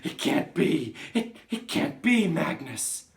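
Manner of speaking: high, loud, stammering disbelief; horrified cry. Acted. Shocked Trunchbull denying it is Magnus, crying out. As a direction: refuse to believe it, crying out. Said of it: "she cried".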